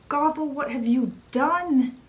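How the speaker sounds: mock scream, pitched high; retold scream. Mother sees her flowers burning, mild high mock scream. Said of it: "Manya starts screaming".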